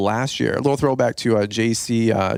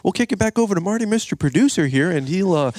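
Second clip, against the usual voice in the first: high, fast, hammed-up host voice; a cheesy radio host tossing it over; mock host handoff; 7 semitones higher than usual. Friend jokes about rambling, mock host voice handing off.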